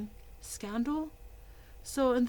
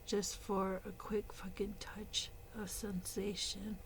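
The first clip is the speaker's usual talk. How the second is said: hushed unvoiced aside; a quiet disgusted whisper; 7 dB quieter than usual. Real, not acted. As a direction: breathe it, barely audible. Muttered aside before the rant starts.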